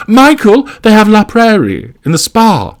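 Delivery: very high, loud, dramatic call; momentous announcement. A narrator's reading. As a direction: announce it like earth-shattering news. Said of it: "tones as if she was announcing a presidential assassination".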